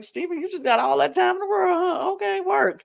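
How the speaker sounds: high, fast teasing scold, laughing through it; mock scolding. Friend mock-scolding Steven to get to work, high and fast. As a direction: tease him like a bossy coach. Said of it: "I'm like".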